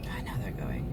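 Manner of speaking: whispered under breath; whispering. Real, not acted. Whispering while waiting in traffic.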